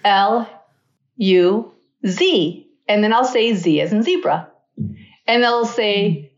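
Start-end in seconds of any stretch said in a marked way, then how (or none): 0.0-2.7 spelling it again. Retold or imitated. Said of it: "So I do it again".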